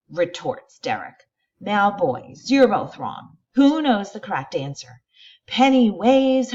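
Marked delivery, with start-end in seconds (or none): 1.6-5.0 stern teacher. Acted. Teacher scolds the boys.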